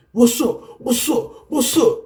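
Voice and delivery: high-pitched confrontational challenge, repeated; mock aggression. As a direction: act out running up on people for a fight. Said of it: "you have aggression. You run up on people".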